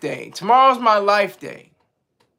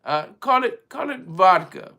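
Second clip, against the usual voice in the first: hammed-up amateur Russian accent; terrible Russian accent; 3 semitones lower than usual. Russian naming a new drink for Vladimir, in a terrible Russian accent. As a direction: put on a bad Russian accent that slips toward Spanish. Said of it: "this is my terrible Russian accent".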